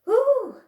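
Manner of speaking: squealed high ooh going up. A narrator's reading. Piglet flung up in the air, high squeal. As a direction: shriek as you fly up. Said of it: "he said".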